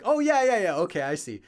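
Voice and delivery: mock "oh, now I get it"; imitated sudden recognition. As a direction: act out the penny dropping. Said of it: "they're like".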